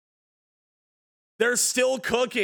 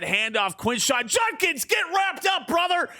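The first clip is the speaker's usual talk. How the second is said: higher, hyped shouting commentary; excited call; 9 semitones higher than usual. Acted. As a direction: yell the tackle like a hyped broadcaster. Big hit in the game.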